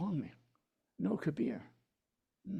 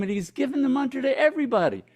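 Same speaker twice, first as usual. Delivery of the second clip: excited narration; 10 semitones higher, 7 dB louder than usual. A narrator's reading.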